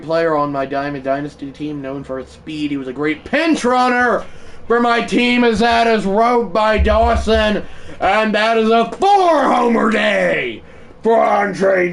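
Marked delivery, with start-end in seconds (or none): 3.2-11.9 higher, loud, slow home run call; hyped sportscaster call; play-by-play call. Acted. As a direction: call the homer like a broadcaster.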